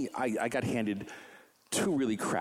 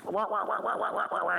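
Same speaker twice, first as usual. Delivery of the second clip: muffled trombone wah-wah; Charlie Brown adult voice; 11 semitones higher than usual. Retold or imitated. Jim's voice heard through the phone, like Charlie Brown's teacher. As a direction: mimic the Peanuts teacher's wah-wah. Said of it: "it's a Charlie Brown".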